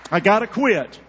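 loud worked-up preaching. Real, not acted. Preacher to church, loud self-stop. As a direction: tell yourself to stop, loudly.

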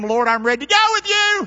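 high, loud eager vow; boastful Peter. Acted. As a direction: shout it as a brash disciple. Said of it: "Here's Peter".

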